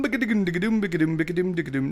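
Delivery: soft rhythmic nonsense filler; quiet running bigadoom patter; idle scatted bigadoom. Real, not acted. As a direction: mumble a bouncy bigadoom riff to yourself. Idle filler noise while checking stream.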